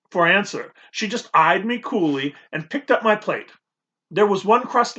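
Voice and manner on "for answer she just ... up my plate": plain reading voice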